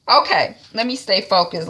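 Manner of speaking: ordinary delivery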